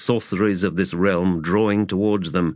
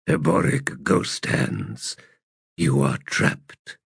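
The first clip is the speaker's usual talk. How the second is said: ancient god voice; 4 semitones lower than usual. Acted. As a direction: speak as a calm ancient deity. Elder god speaks.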